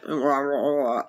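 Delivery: low, mumbled nonsense like someone half asleep; mock sleepy grumble. Imitating Nikki refusing to get up, low sleepy grumble. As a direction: grumble it like a friend who won't get out of bed. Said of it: "And you'd be like".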